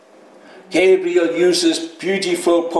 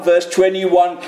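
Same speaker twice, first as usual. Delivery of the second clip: normal talking voice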